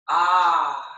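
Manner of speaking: loud open ah sigh on the out-breath; dramatic sigh. Shown as a demonstration. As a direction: let it all go with one big audible sigh. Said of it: "let it be a big sigh out".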